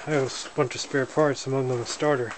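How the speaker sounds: normal talking voice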